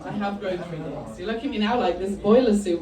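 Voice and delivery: usual speaking voice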